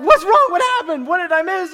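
a father panicking at his daughter's scream; alarmed dad. Retold or imitated. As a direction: rush to find out what happened. Dad alarmed by crying child.

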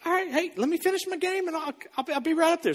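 high, breezy brush-off while gaming; distracted gamer reply. Dad answering his daughter mid-game, high and breezy. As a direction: toss it off over the headset.

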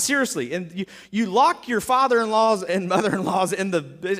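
usual speaking voice

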